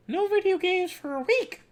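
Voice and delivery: very high, squeaky mom voice; Mickey Mouse mom. Acted. Mom hands down the punishment, Mickey Mouse falsetto. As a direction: lay down the rule in a falsetto. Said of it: "my mom is Mickey Mouse now".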